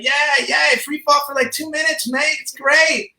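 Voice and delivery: put-on high-pitched, gung-ho Kiwi accent; mock New Zealand accent. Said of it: "my accent was off".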